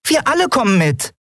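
high tiny creature voice; piping. A narrator's reading. Nelen speaks for the whole crew, high piping. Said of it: "piepste Nelen".

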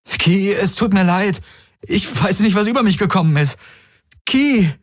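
high, urgent apology; frantic begging, calling out the name; desperate pleading. A narrator's reading. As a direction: plead at high pitch while chasing. Running after her, apologising.

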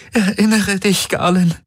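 high, begging plea; pleading. Acted. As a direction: beg him to remember. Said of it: "flehte Kirai".

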